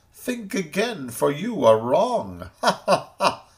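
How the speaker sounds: high, mocking kangaroo voice with a laugh; kangaroo scolding. A narrator's reading. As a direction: tease them for being fooled. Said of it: "loud he shouted with reproach".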